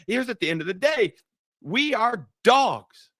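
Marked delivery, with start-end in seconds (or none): 1.6-3.1 slow emphasis. Acted. Preacher slow emphatic declaration.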